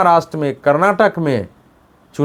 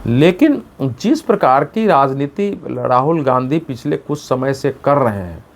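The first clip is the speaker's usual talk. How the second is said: normal talking voice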